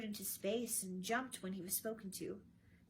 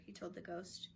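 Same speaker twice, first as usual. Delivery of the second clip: ordinary delivery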